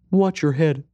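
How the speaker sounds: put-on high, small child voice; little boy. A narrator's reading. Boy tells stranger about lost mother, little boy's voice. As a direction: speak as a young boy, pitched high. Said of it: "said the little boy".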